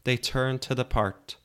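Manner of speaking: plain reading voice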